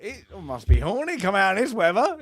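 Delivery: high, loud hammed-up English gent; imitating English Bob. English Bob greeting the sheriff in the rain, hammed-up imitation. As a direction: ham up a prissy Brit soaked by the storm. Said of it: "And like that".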